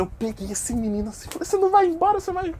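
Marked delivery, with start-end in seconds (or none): 1.5-2.5 much higher taunting voice; mock menacing taunt. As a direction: threaten him in a sing-song. Narrator finishing the customer. (ameaça debochada em voz aguda)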